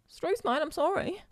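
put-on high, apologetic, overdone Australian accent; bad Australian accent. Nervous apology to the Australian girl, worsening Australian accent. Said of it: "Yeah, it went worse".

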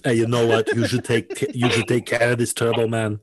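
Arnold Schwarzenegger voice. Shown as a demonstration. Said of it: "he gives the toy to Sinbad".

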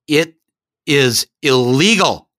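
It, is, illegal each hit slowly; hitting each word. Acted. Doctor hammering It is illegal at the boy, each word slow. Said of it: "he hit every one of those words".

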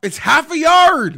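loud and drawn out in disbelief; exasperated shout. Real, not acted. Fan yelling at the player who fumbled near the goal line, exasperated. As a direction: yell it slowly at the fumbler.

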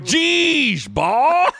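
high, drawn-out exasperated coach; Coach Altman. Retold or imitated. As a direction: imitate an exasperated coach crying out. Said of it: "and it's Coach Altman".

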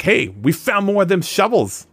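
high-pitched eager henchman voice; dim henchman. Acted. Henchmen reporting their find, high goon voice. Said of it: "they're like".

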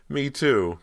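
forgetting all fear, calling out eagerly; eager cry. Acted. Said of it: "Cody cried".

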